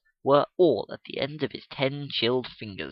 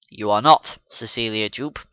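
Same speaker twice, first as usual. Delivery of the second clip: solemn; 3 semitones lower, 6 dB louder than usual. Acted. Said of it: "solemnly repeated".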